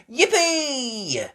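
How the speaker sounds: high, loud happy squeal; little girl cheering. Acted. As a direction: cheer with joy. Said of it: "cheered Peppa".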